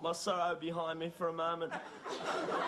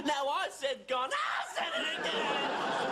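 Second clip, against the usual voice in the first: high, slow, loud bawling; hammed-up crying shout; wailing; 6 semitones higher, 4 dB louder, slower than usual. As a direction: howl in comic anguish, drawing words out. Breaks down over the word gone.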